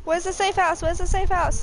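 high, urgent repeated question; panicked. Real, not acted. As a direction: ask frantically while fleeing danger. Urgent search for safety at night.

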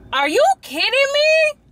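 shrieking in outrage at the dead battery; outraged shriek. Real, not acted. Sees the camera on one bar.

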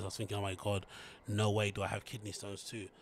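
ordinary talk

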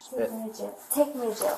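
soft, sullen repeated demand; muttered demand. Real, not acted. Arrested woman mumbles demand.

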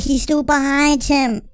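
ordinary talk

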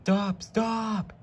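high yell of alarm. A narrator's reading. Henry yelling at the panicking freight cars. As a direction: shout for them to stop. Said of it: "yelled Henry".

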